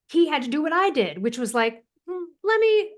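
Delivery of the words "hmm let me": high, mock-pondering hmm; musing doctor. Imitating Doctor Forsman deciding to dig into thyroid, high and pondering. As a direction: lightly play the curious doctor thinking it over.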